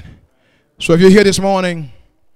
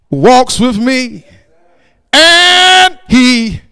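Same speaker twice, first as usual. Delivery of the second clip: loud, chanted sermon cadence; rhythmic, fervent pulpit proclamation; full-force preaching; 6 semitones higher, 6 dB louder, much slower than usual. Acted. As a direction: preach it full voice with drawn-out phrase endings.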